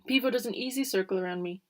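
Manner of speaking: ordinary delivery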